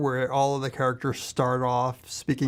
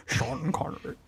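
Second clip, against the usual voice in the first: Sean Connery voice; 6 dB quieter than usual. Retold or imitated. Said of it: "And then he's just".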